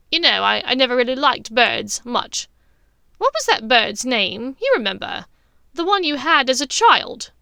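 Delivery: loud, probing, testing voice; pointed Bellatrix. Acted. Bellatrix tests her sister's identity, loud pointed probing. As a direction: push the question hard and loud.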